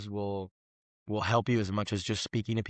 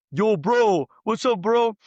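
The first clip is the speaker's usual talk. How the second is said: high, loud mocking street greeting; guy across the street; 9 semitones higher, 6 dB louder, slower than usual. Local guy hollers across the street, high loud mock bro voice. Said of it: "this guy goes".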